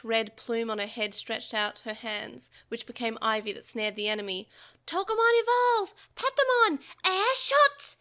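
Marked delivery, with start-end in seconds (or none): 4.9-7.9 loud, high creature shout, slow on the attack; battle cry. A narrator's reading. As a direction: announce the attack with force. Digimon calling evolution and attack.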